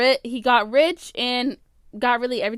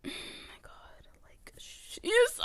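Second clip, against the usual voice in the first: whispered, slow and exasperated; 11 semitones higher, 12 dB quieter, slower than usual. Real, not acted. Whispered aside about annoying person.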